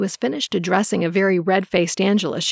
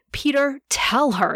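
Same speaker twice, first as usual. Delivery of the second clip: higher, slow, lightly voiced bellow; 6 semitones higher, slower than usual. A narrator's reading. Angela bellows at Peter turning his back, a mild higher shout. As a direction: command him as he turns away. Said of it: "Angela bellowed".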